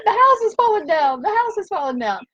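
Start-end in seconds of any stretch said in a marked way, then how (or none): 0.0-1.2 high, loud laughing outburst; mock alarm, laughing; laughing exclaim. Real, not acted. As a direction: blurt it out through laughter. Laughing at construction noise.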